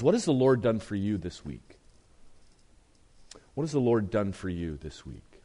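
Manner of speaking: ordinary talk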